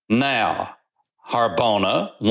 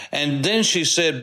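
ordinary delivery

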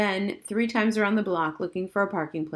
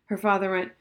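plain reading voice

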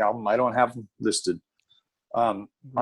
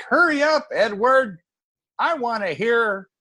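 mock impatience; 9 semitones higher than usual.

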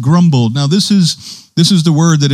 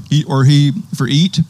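usual speaking voice

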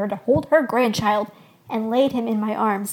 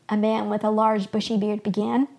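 ordinary delivery, nothing marked

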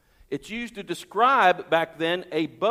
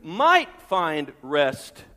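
"might" stretched and raised; deliberate, weighty emphasis on "might"; stress on MIGHT; 2 semitones lower, 3 dB louder, much slower than usual. Shown as a demonstration. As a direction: hit "might" hard, slowly. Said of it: "I emphasize the word might".

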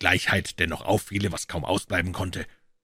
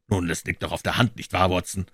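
plain reading voice

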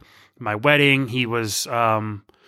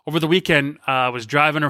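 ordinary talk

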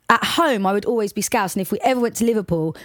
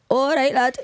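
Scouse accent; 6 semitones higher than usual. Shown as a demonstration. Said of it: "your cue to do some Scouse".

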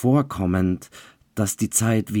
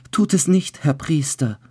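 sobbing plea; 9 semitones higher than usual. A narrator's reading. Bride begs the priest not to marry them, sobbing. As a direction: beg the priest through tears. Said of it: "wiederholte das Mädchen schluchzend".

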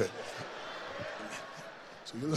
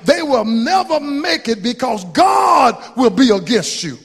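preaching at full force; 5 semitones higher, 4 dB louder than usual. Acted. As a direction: thunder it from the pulpit.

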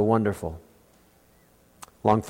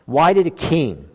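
usual speaking voice

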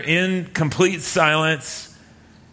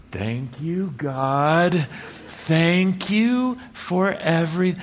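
brother prompting prayer; 3 dB quieter, much slower than usual. Retold or imitated. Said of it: "one of her brothers will go".

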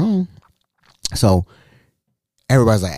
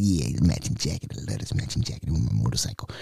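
low bragging sous chef; 11 semitones lower, 11 dB quieter, faster than usual. Retold or imitated.

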